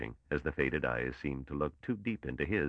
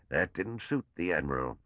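ordinary delivery

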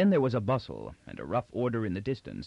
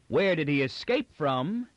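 lofty, sneering mockery; high sarcasm; 6 semitones higher, 4 dB louder than usual. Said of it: "he declaimed in high sarcasm".

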